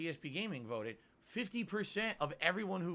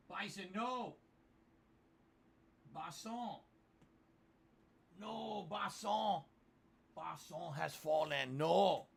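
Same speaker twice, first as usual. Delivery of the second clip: drawn-out, higher-pitched melodramatic lament; mock dismay; 4 semitones higher, much slower than usual. Streamer laments that Bison has fallen, mock dismay. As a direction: mourn a fallen comrade, hammed up.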